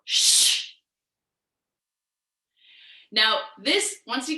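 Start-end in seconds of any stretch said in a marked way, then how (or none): 0.0-0.7 long hushing shh like a stern librarian; forceful breathy shush. Shown as a demonstration. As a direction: shush it out like you're told off in a library. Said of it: "So again, we inhale".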